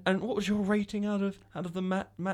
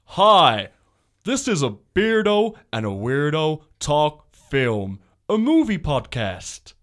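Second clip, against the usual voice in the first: slow, booming trailer narrator; movie trailer voice; 4 dB louder, slower than usual. Pretend. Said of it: "should I do my movie voice?".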